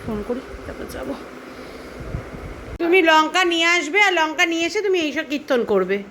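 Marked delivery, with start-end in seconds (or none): 2.8-6.0 exasperated scolding. Real, not acted. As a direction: tell her off, fed up. Scolding about the chillies. (বিরক্ত হয়ে উঁচু গলায় বকুনি)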